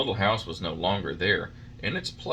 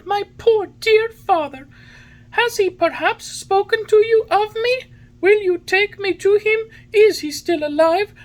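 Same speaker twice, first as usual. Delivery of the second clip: very high, loud, eager puppet voice; urgent pleading; 8 dB louder than usual. A narrator's reading. Pinocchio begs the pigeon for news of his father, pleading. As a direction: fire off anxious questions, high and loud.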